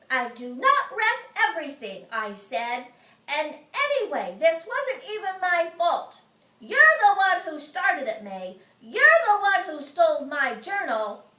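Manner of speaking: loud indignant protest; mad. Acted. Junie arguing back at May, loud and indignant.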